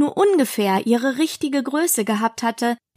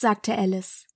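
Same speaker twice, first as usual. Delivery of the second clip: ordinary delivery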